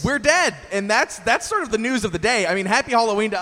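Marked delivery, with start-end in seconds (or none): 0.0-0.5 higher, louder reveal; excited announcement. Pretend. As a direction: announce it with glee. Announcing their own death.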